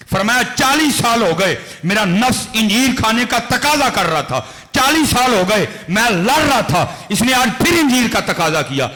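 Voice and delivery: loud, high, impassioned sermon storytelling; preaching at full force. Acted. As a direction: preach it out to the crowd with fire. Tells Junaid story at full force.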